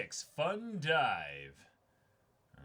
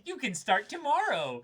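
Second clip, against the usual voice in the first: loud playful banter; 9 semitones higher, 10 dB louder than usual. Acted. Boss character recruits the student.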